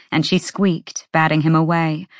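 ordinary delivery

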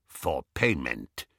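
low, slow, firm goblin. A narrator's reading. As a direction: set the terms slowly and low. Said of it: "said the goblin firmly".

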